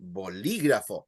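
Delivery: "bolígrafo" with emphasis on "lí"; stress on LI. Shown as a demonstration. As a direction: lean on the written accent in "bolígrafo". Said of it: "the emphasis on li".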